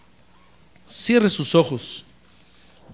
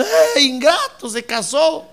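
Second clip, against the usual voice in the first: mock wailing mother; 6 semitones higher than usual. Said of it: "los padres llorando".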